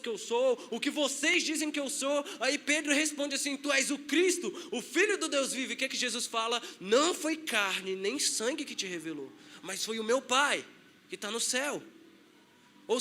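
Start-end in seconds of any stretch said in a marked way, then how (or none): none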